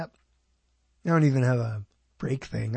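ordinary delivery

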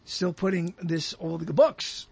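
ordinary talk, nothing marked

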